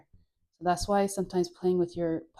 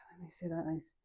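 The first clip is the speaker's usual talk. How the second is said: whispering; 2 semitones lower, 12 dB quieter than usual. Real, not acted. Whispered aside.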